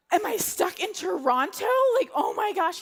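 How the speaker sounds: mock-panicked disbelief; distraught. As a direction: blurt it out near despair. Tearful outburst at being stranded.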